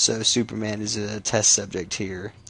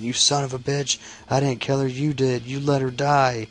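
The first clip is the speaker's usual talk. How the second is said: mildly angry retold outburst, pitched up; super pissed; 4 semitones higher than usual. Barry accuses the man over her death, super pissed. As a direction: act out an indignant accusation, higher than usual. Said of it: "looking super pissed".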